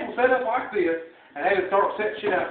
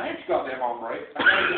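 ordinary talk

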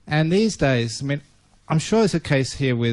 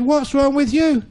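cheery spider; 11 semitones higher, 5 dB louder than usual. Acted. Happy spider greets crying spider.

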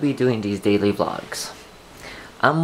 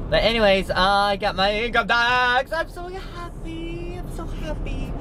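much higher, squealing joy; excited. Real, not acted. Vlogger gushing to viewers about her refund, much higher. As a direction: gush happily.

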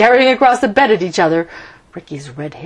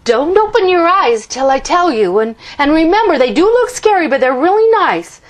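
cautioning; 9 semitones higher, 7 dB louder than usual. A narrator's reading. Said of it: "Ricky cautioned his parents".

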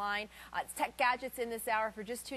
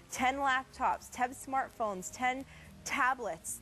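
slow emphatic list; 8 semitones higher, much slower than usual. Acted. Listing items slowly for emphasis.

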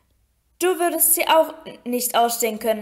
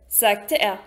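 ordinary delivery

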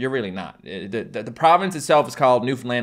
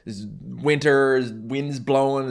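ordinary talk, nothing marked

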